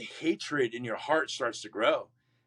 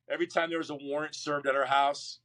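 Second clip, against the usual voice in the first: normal talking voice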